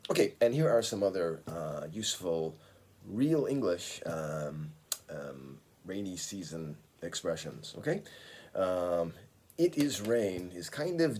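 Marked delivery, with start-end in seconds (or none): none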